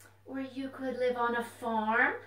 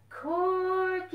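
sung-out call to the puppet; 8 semitones higher, 4 dB louder than usual. Pretend. Librarian calling the puppet out to the children, singing the name. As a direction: call his name high and drawn out. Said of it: "Let's call him".

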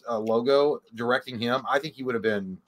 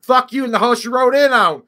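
playful shout; 10 semitones higher, 9 dB louder than usual. Real, not acted. Playful outburst at co-host.

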